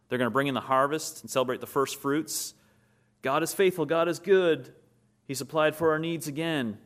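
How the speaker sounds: exultant preacher proclaiming; joyful preaching. Acted. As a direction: lift the room with praise. Preaching at full force.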